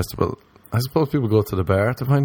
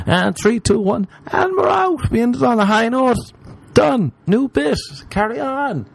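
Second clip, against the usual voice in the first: much higher, brisk hammed-up host; mock showman countdown; 12 semitones higher than usual. Comedian parodying a bit ending on a high note, as a hammed-up host. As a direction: count down like a game-show host and sign off.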